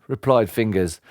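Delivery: ordinary delivery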